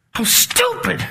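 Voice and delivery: lightly hammed-up self-mockery; mock self-scolding. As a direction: scoff at your own foolishness in a high voice. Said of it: "I gave up all this".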